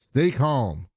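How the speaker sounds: ordinary talk, nothing marked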